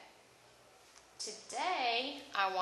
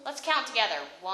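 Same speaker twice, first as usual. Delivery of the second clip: kindergarten teacher voice; 7 dB louder, faster than usual. Real, not acted. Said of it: "teacher leads counting".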